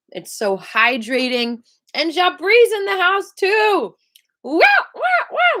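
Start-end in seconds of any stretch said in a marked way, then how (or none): 1.9-4.0 high, loud welcome call; excited greeting. Real, not acted. Greeting another student joining. 4.4-5.6 very high, loud squealed wows; delighted wow. Real, not acted. Reacting to students arriving.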